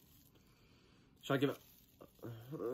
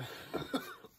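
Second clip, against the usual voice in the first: pained laugh; 6 dB quieter than usual. Real, not acted. Laughing in pain.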